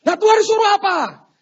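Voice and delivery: very high, loud demand; acted outraged question; exasperated shout. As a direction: demand the real orders at full volume.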